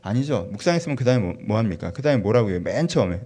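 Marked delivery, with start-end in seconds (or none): none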